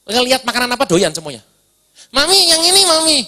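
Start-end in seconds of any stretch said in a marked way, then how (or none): 2.1-3.2 put-on high, pleading little-girl voice; child tugging at her mother, begging for a treat. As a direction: whine for the snack like a small daughter. Daughter begging mother for snacks.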